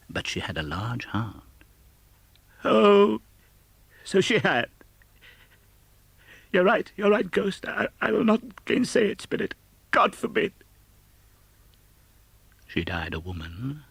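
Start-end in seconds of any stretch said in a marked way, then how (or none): 2.6-10.5 put-on lifted, moved old man's voice; an old miser softened by memory. Scrooge answers the Ghost.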